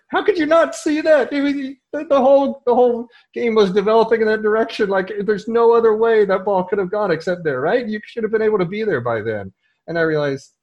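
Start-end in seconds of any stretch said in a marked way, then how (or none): 0.1-9.5 much higher and louder, exasperated disbelief at the player; frustrated coach. Retold or imitated. Coach exasperated at a player who missed the play, loud and high. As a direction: let the exasperation burst out, pitched way up.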